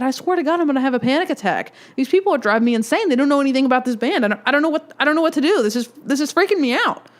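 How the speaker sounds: high, fast, near-panic rant; frantic panic. Retold or imitated. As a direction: spill it out fast like you're about to lose it. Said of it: "I said".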